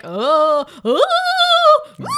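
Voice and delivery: loud, high mock wailing; hammed-up "oooh" that swells and climbs; ridiculous ghost moans. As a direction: wail like a badly faked ghost. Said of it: "Making the most ridiculous".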